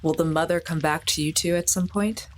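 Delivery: ordinary talk, nothing marked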